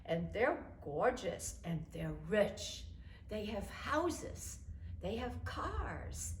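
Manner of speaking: slow, drawn-out enticing list; savoring list. Retold or imitated. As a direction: friend tempts the grandmother with rich men, slowly savoring. Listing the rich men.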